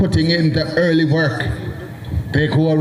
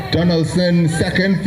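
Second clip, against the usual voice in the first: ordinary delivery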